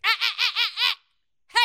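high, repeated cut-off bleats; scolding noise. Acted. Imitating people policing a topic, scolding interjections. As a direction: mimic someone shutting a topic down.

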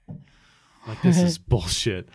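ordinary delivery

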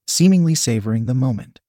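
plain reading voice

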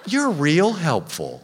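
slow, loud, mocking drawl; sarcastic robber. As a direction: sneer it out slowly. Robber's parting sarcastic line.

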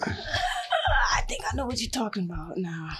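whispering. Real, not acted. Echoing friend's line in whisper.

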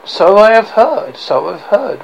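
put-on breathless, high, loud old crone; wheezing old woman. A narrator's reading. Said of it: "wheezed the old woman".

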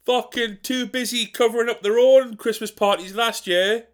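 high, whiny self-pitying moan; martyred grumbler. Acted. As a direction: sulk like a put-upon martyr. Old Dick Lips persona.

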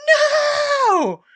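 long high-pitched wailing shout; loud, squealed, drawn-out protest; panicked hamster. Acted. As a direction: cry out like a terrified tiny creature. GM voices panicking hamster character.

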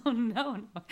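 laughing. Acted. Delighted reaction to the confession.

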